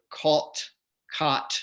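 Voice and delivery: "caut" with rounded aw, "cot" with open ah; unmerged vowels. Shown as a demonstration. Host names the merger, slow distinct vowels in "caut-cot". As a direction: slowly separate "caut" from "cot". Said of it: "they haven't really done that".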